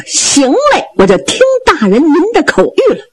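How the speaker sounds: high, fast, laughing braggart voice; cocky sidekick angling for praise; boastful Zhao Bi. Acted. Zhao Bi accepting the order.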